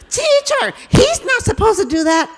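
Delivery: put-on a child telling on a classmate to the teacher; tattling child.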